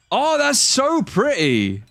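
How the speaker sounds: high, slow, swooning "oh"; gushing delight. Real, not acted. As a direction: melt over how pretty it is. First sees guest's new model.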